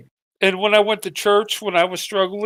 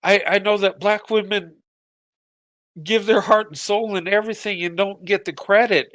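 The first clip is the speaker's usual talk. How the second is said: usual speaking voice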